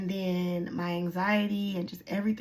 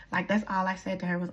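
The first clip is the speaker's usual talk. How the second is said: ordinary talk, nothing marked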